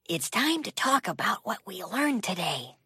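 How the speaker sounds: whispered, breathy and hushed. Acted. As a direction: hush it so the song won't play. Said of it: "why are you whispering?".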